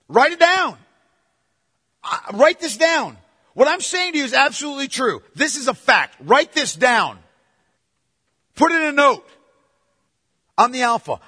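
loud, driving pulpit preaching; preaching at full force. Acted. Preacher urging his congregation about Revelation, loud and driving. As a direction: preach it out to the whole church.

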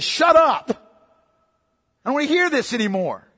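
imitating a fed-up leader's rebuke; emphatic rebuke. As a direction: cut them off with no gentleness. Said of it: "These are emphatic statements in the Hebrew".

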